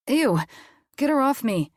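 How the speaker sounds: high, loud squeal of disgust; recoil, squeamish and high. Acted. Unconscious girl falls on him.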